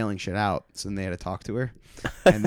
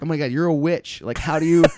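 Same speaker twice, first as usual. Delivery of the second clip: mock panic; 6 semitones higher, 5 dB louder than usual. Retold horror at returning heckler.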